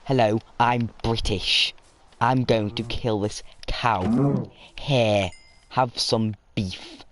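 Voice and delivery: slow, hammed-up British caricature; exaggerated British accent, drawn-out words; mock British accent. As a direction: drag out a ridiculous British accent. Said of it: "nobody I know speaks like this".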